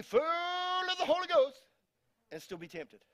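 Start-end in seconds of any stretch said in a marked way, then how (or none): 0.0-1.6 high, stretched mock American accent; exaggerated American preacher accent. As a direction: draw FULL out long in hammed-up American. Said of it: "I'm doing an American accent there".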